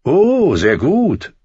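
high-pitched mock-admiring sigh. A narrator's reading. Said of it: "seufzte Littlefinger".

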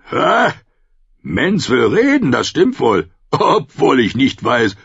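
boisterous Tormund, mocking and glad; booming wildling chief. A narrator's reading. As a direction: greet him like an old rough friend. Tormund voiced.

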